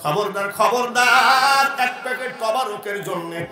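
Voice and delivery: loud, half-chanted exhortation; full-force preaching. Acted. (উচ্চস্বরে সুরেলা ওয়াজ)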